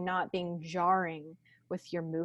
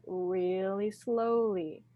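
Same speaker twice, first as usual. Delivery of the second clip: slow; much slower than usual. Shown as a demonstration. Demonstrates reaching slowly.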